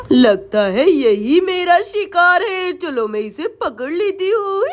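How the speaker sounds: drawn-out storybook witch voice; scheming witch. Acted. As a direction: plot slowly like a witch. Witch decides to grab him. (शिकार पर नज़र रखती चुड़ैल की आवाज़)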